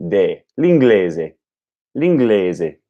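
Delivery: De, l'inglese, eh vowel with no glide to ee; pure e vowel. Shown as a demonstration. Learner fixing the vowel, slow pure e in l'inglese. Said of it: "without the glide at the end".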